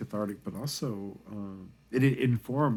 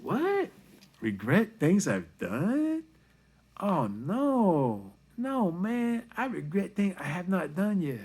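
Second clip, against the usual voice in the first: high, drawn-out imitated protest; Bruce impression; 10 semitones higher, 4 dB louder, slower than usual. Student scoffing at regret, high imitated voice. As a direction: pitch up high, incredulous at the very idea. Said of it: "he said".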